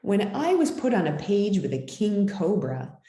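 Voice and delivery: ordinary delivery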